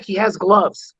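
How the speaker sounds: ordinary talk, nothing marked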